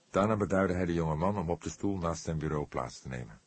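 ordinary delivery

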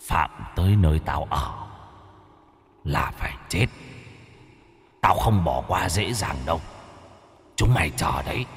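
low, soft ghostly threat; menacing ghost. Acted. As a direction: hiss out a cold threat from the dark. Ghost threatens the guardian spirit. (giọng ma trầm, nhỏ, đe dọa lạnh lùng)

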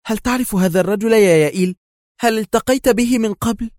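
high, loud anxious questioning; very tense. A narrator's reading. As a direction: demand an answer with voice strained upward by nerves. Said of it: "سألته نينا في توتر بالغ".

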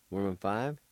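ordinary talk

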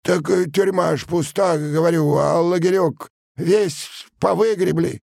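high, halting excuses; pleading peasant. A narrator's reading. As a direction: explain desperately in a high-pitched voice. (мужик умоляюще оправдывается)

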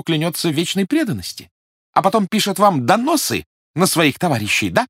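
high, sarcastic feigned praise; mock admiration. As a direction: gush with biting sarcasm. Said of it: "сделанным восхищением".

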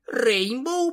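high squeaky surprised Рейнбоу; surprised squeak. A narrator's reading. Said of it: "пропищала удивлённая Твайлайт".

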